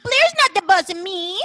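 put-on high, loud drunken whine; drunk Serena. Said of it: "like, really drunk and it's really terrible".

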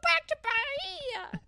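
high, whining plea to go back; squeaky mock whine; whiny Donald. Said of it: "and he's like".

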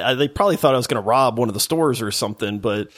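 usual speaking voice

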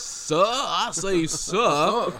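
put-on slow, drawn-out Southern gentleman accent; hammy old Hollywood Southern accent; old-timey Southern accent. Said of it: "a Northern actor in the forties doing a Southern accent".

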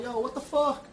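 high-pitched pretend freak-out; mock alarm. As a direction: play it startled, voice jumping up high. Imagined shock, jumping out of bed.

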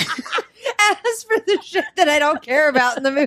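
high, loud mimicking through laughter; real laughing. Real, not acted. Teasing co-host's reading about demon stuff, laughing. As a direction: echo it back, cracking up. Cracking up while echoing the reader.

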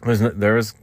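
usual speaking voice

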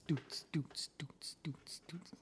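soft, unvoiced mouth beat; whispered beat noises. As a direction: whisper a little club beat under your breath. Imitating a beat for DJ Mike.

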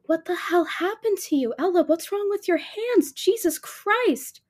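horrified at seeing her friend hurt; alarmed. Acted. Faye seeing Ella's bandaged hands, high alarmed shock.

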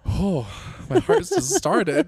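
high, loud startled cry; jolted by a jump scare. Real, not acted. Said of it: "That was a jump scare for you?".

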